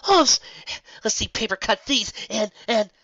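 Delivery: high giggly voice bubbling over into a laugh; laughing delight. Acted. Lyra laughing as her magic comes back, giddy and high. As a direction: laugh it out, then chirp the words up high.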